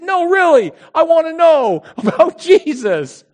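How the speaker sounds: high, loud, slow pleading with a laugh; insistent. Retold or imitated. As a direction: beg them to believe you.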